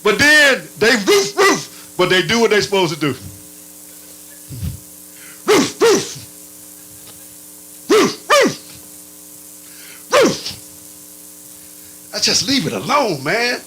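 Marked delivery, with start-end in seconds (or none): 5.5-12.1 high yelping barks like a grumbling dog; dog barking. Acted. Preacher mimics a grumbling son, high dog barks. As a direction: bark it out, rising each time.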